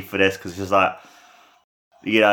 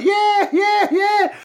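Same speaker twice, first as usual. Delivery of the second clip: crowd cheering; much slower than usual. Retold or imitated. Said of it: "the crowd just go like".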